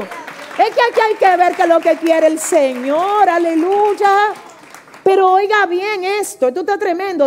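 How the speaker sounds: proclama con fervor, alargando el aleluya; voz alzada de predicadora encendida; prédica exaltada. Real, not acted. (fervor a plena voz)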